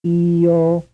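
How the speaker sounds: two distinct vowel beats; vowel pair demo. Shown as a demonstration. Teacher sounding out a quiz item. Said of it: "How many syllables do you hear".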